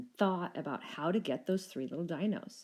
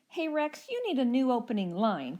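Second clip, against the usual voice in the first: higher, louder teasing call; cheeky dino call; 6 semitones higher, 5 dB louder than usual. A narrator's reading. Second little dino calling back to the Rex, high and teasing. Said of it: "called back the second little dino".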